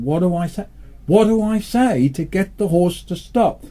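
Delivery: high, rising panic, words stretched; a rider begging his memory for the word; panicked rider. Retold or imitated. Storyteller voicing the rider's panic as the horse nears the cliff. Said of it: "And he's going".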